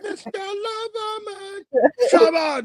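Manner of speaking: high yelled dancehall toast, stretched on Mister Lover; [name] impression. Mocking [name] to his friend, a high yelled dancehall toast. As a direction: bellow it like a dancehall MC hyping the crowd.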